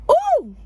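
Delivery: high, loud delighted ooh; a giddy swoon at the romance. Real, not acted. Swooning over obsessed hero.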